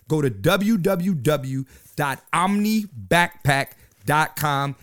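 slow web address, each part set apart; slow, deliberate repeat. Real, not acted. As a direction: spell out w w w dot omni backpack dot com slowly.

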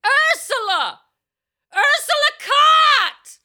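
high, drawn-out hammed-up scream; imitated scream. Crazy lady chasing a star down the street, imitated screaming. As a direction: scream the name after someone running away. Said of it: "She ran after her screaming".

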